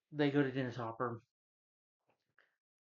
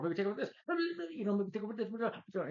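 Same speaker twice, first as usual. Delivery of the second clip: high-pitched mock rant; mock yelling; 10 semitones higher than usual. Reviewer mimicking a character going on, high mock yell. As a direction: imitate someone yelling on and on. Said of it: "He's just yelling".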